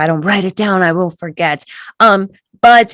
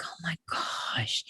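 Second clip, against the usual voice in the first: slow, whispered dismay; shocked whisper; 2 semitones lower, 18 dB quieter, slower than usual. Retold or imitated. Snapping out of a rage, a slow whisper. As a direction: breathe it out in dismay. Realizing after an angry blow-up.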